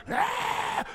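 mock angry child's cry; high, harsh imitation tantrum scream. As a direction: bawl like a cross child, hammed up. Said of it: "Or the angry cry".

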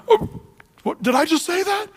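high, startled mock gasp; shocked. Preacher voicing Balaam startled by his own blessing, high mock surprise. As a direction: caught off guard by his own words.

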